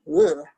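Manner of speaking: loud, low "ugh"; heavy grunt of disgust; disgusted groan. Real, not acted. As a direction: groan with dread and distaste. Disgusted noise before the grim part.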